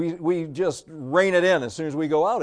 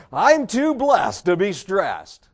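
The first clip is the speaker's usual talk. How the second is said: slow, higher and louder, emphatic; loud declaration; 5 semitones higher, 7 dB louder, much slower than usual. Acted. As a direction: insist on it despite the breakdown. Declares slogan after blowout.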